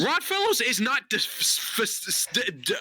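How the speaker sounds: tripping over the consonants; real stammer, stuck on sounds; stuttering. Real, not acted. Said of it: "I stuttered my whole fucking soul away".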